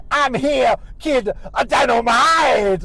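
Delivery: loud, high sitcom entrance, last word stretched. Retold or imitated. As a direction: burst in hollering a catchphrase. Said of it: "then J J comes in the room".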